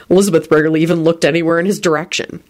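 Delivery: ordinary delivery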